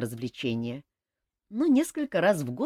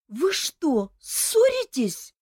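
high, drawn-out plaintive cry; worried girl pleading; 11 semitones higher, 4 dB louder, much slower than usual. A narrator's reading. Olga to the cousins, plaintive cry. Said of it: "жалобно вскричала Ольга".

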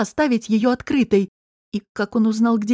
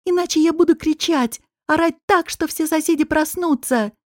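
high, urgent warning; desperate threat; 7 semitones higher than usual. Acted. Woman warns intruder she will rouse neighbours, desperate. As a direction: threaten him with pitch raised in alarm.

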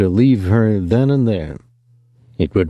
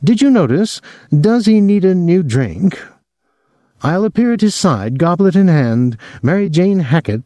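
a lightly raised, flirty woman's voice; Mary Jane, lightly voiced; 8 semitones higher than usual. A narrator's reading. Mary Jane volunteers to serve the drink, lightly voiced woman. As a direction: offer yourself as the eager cupbearer, lightly voiced.

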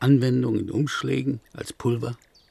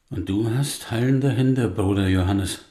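normal talking voice

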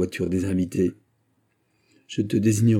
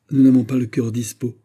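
short, dry and louder, a bit higher; curt reply; 5 semitones higher, 6 dB louder than usual. A narrator's reading. Maritza curtly refusing the prince, dry and raised. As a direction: cut him off coldly. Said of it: "répliqua sèchement Maritza".

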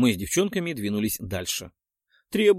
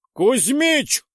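loud, high bark of a name; barking shout; 12 semitones higher, 7 dB louder than usual. Acted. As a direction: bark it into his ear. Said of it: "рявкнул я в волосатое ухо".

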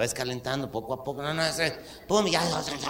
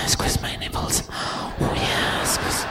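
slow whispered English moaning; porn actress; much slower than usual. Acted. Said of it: "Así decía la pinche vieja". (susurro gimiente de actriz porno)

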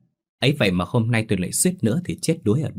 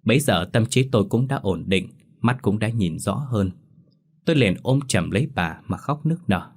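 plain reading voice

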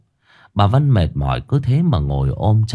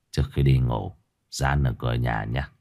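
softer, lower confiding murmur; 8 semitones lower, 6 dB quieter than usual. A narrator's reading. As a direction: murmur it like a secret. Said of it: "thổ thỉ". (thì thầm nhỏ nhẹ, giọng trầm)

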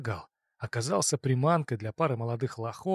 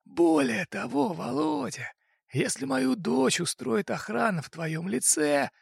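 raised-pitch voice for the fat boss; crime boss voice; 9 semitones higher, slower than usual. Acted. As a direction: play the smug boss making an offer.

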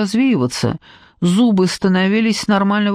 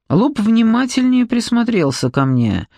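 plain reading voice

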